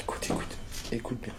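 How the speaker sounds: urgent hushed whisper. Real, not acted. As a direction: whisper to make him listen. Telling friend to listen silently.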